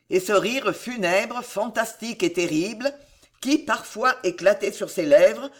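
ordinary delivery

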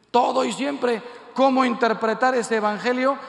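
loud, high challenge to the crowd; forceful preaching. Real, not acted. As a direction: ring it out from the pulpit. Preacher challenges crowd with Gospel words. (predicando con voz fuerte y aguda)

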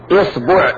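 إصبع said "isbu'", i then u; kasra, then damma. Shown as a demonstration. Teacher showing kasra forms, إصبع as "isbu'". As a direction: say إصبع with i on hamza, u on baa. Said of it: "نأخذ كسر الهمزة".

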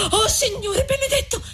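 very high, thin cry of amazement; high-pitched shocked exclamation; astonished cry. A narrator's reading. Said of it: "guarda più attenta e grida".

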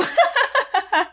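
high-pitched forced laughter; phony Snow Maiden laugh; put-on peal of laughter; fake laugh. A narrator's reading. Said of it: "фальшиво рассмеялась Снегурочка".

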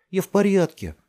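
put-on high, loud child's shout; called out. A narrator's reading. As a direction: call back that all is fine. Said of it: "крикнула ей Делия".

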